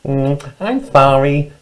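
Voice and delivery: slow, twittery rabbit apology; twittering rabbit. Acted. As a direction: apologise as a fluttery storybook rabbit. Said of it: "twittered Rabbit".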